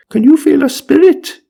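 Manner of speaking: high-pitched mock wonder; comic séance guest. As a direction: squeal the question as a silly character. Voices someone asking the medium.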